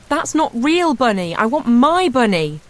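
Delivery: high, loud, slow upset squeal; a toddler refusing the wrong toy; squealing child. A narrator's reading. Little girl squeals at her brother over the wrong rabbit, high and loud. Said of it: "let out a squeal".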